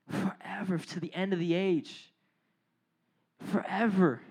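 low, soft, solemn assurance; hushed preaching. Real, not acted. Preacher comforting listeners with God's lasting presence, hushed. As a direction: bring it right down, low and reverent.

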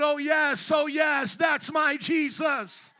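loud, drawn-out cry of praise; joyful shouting out. Acted. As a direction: shout it out like a triumphant believer. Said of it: "you'll be shouting out".

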